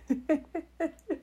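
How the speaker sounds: a high burst of laughing; high surprised laugh. Real, not acted. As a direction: laugh at being caught out. Laughs after truth bomb.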